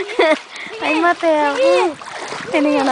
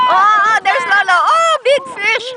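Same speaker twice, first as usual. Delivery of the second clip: excited; 6 semitones higher than usual. Real, not acted. Excited family showing baby a fish.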